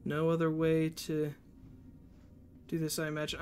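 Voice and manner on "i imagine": ordinary delivery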